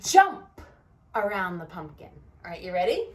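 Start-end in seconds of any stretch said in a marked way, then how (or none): 0.0-0.6 sudden loud high jump; big playful shout of jump; excited jump. Shown as a demonstration. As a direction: burst out with it like a game starts.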